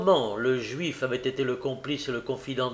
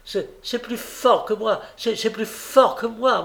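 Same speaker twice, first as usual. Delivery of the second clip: flustered protest; 8 semitones higher than usual. A narrator's reading. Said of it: "répliqua le chef du dépôt".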